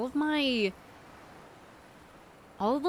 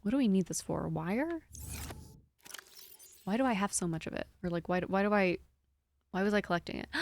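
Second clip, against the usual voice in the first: normal talking voice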